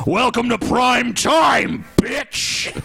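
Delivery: slow, high taunting shout; Freddy Krueger voice. Retold or imitated. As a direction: gloat like a horror villain. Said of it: "he also says".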